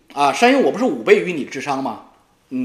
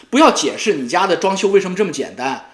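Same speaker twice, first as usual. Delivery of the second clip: usual speaking voice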